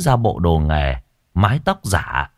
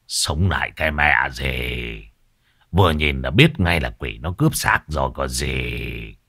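gruff retort; 7 semitones lower, 4 dB quieter than usual. A narrator's reading. Tam Vâu scoffing at the villagers, lower gruff voice. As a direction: scoff at the rumour. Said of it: "Tam Vâu nó mới quát to".

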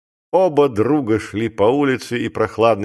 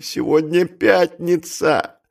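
slow, higher-pitched dread; faltering dismay; 5 semitones higher, slower than usual. A narrator's reading. Clerk counting the days to the audit, faltering. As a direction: draw it out as the horror sinks in. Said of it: "пролепетал Маковой".

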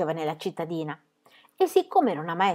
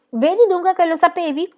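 triumphant exclamation; 12 semitones higher, 7 dB louder than usual. A narrator's reading. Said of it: "esclamò Pippi".

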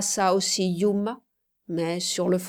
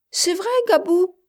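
put-on high-pitched, louder child's question; little girl Mayotte; 12 semitones higher, 6 dB louder than usual. A narrator's reading. Little girl questioning her big friend, eager child voice. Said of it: "dit Mayotte".

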